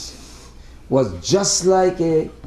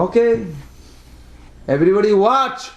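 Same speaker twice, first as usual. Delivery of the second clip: higher-pitched challenge to the crowd; raised, declaring child's retort; acted defiant announcement; 7 semitones higher than usual. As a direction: call everyone to watch, as the child giving in. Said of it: "He really said like this".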